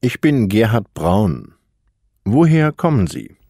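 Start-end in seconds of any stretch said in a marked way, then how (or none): none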